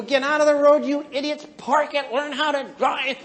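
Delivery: high-pitched road-rage rant; yelling. Acted. Red driver alone in the carpool lane, yelling. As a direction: yell insults at the other drivers. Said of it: "yelling at people".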